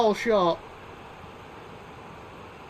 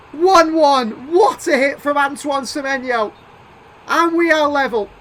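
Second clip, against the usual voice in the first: loud, higher thrilled call; excited commentary; 5 semitones higher, 8 dB louder than usual. Real, not acted. Gamer cheering the equaliser, loud excited call. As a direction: ride the joy of the goal.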